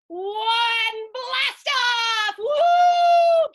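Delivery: high, very loud launch yell; excited shout. Acted. Boy ending countdown with listeners, excited shout. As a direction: shout it like a rocket lifting off. Rocket countdown reaches blast-off.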